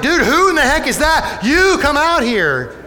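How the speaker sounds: preacher imagining the king yelling; mock shout. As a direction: demand the stranger come out. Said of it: "Why wouldn't he be like".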